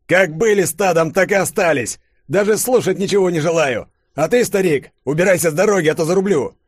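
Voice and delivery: high, loud contemptuous threat; menacing soldier. A narrator's reading. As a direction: threaten to cut him down. Soldier threatens old man. (солдат грозит старику)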